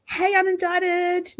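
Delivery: ordinary talk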